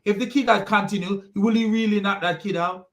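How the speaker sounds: usual speaking voice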